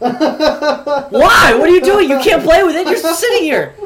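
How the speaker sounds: high, loud comic outrage; outraged protest. Retold or imitated. Boy to classmate keeping his yo-yo, outraged. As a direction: raise voice and pitch in disbelief.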